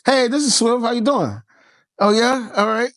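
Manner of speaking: high, polite business greeting; phone voice. Shown as a demonstration. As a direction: pick up a client call in a put-on professional voice. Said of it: "What's my voice?".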